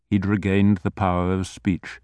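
ordinary delivery

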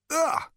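high, loud groan of disgust; disgusted ugh. A narrator's reading. Zaphod spits out the sucked towel corner, disgusted. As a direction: spit out a foul taste, ugh.

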